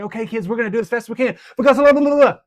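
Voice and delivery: put-on high, loud and rushed like a revved-up kids' leader; fast, high, loud demo for kids; hyped-up fast kid pep talk. Shown as a demonstration. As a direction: race through it hyped for the kids. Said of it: "I'll say".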